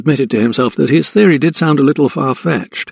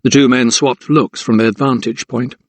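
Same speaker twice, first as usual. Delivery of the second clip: usual speaking voice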